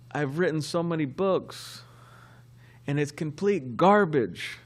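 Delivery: put-on drunk laughing guru. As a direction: play the drunk guru cracking up at his success. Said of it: "He said".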